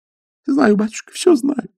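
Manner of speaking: put-on girl begging the stoker through tears; tearful pleading. A narrator's reading. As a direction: plead like a desperate young girl. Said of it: "глотая слёзы".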